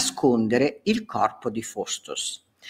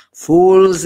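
loud scornful bark; contemptuous shout; 5 semitones higher, 9 dB louder than usual. Acted. Faustus scorning the knights, loud and contemptuous. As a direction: spit the word at them.

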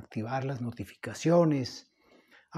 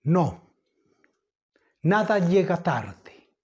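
louder, higher declaimed verse with long pauses; poem recitation; 4 semitones higher, 4 dB louder than usual. Acted. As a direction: declaim the poem grandly, phrase by phrase.

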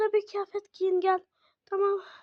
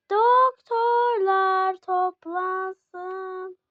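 very slow, stretched announcement; slow stretched hospital call; drawn-out page; 4 dB louder, much slower than usual. Pretend. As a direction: drag every syllable like a page. Pretend hospital page for doctors.

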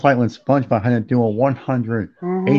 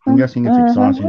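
mock high voice; 13 semitones higher, 4 dB louder than usual. High mocking voice.

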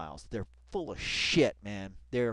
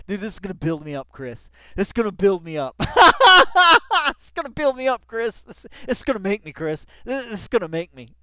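fast, higher and louder, bubbling over; giddy excitement; 6 semitones higher, 11 dB louder, faster than usual. Real, not acted. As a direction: gush like you struck gold.

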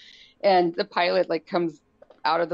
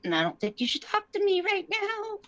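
high, fed-up retort; wife to chatty husband, exasperated and high; 14 semitones higher than usual. Retold or imitated. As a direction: shut him down, fed up. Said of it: "I'm like".

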